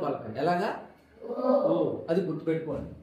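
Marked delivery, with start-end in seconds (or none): none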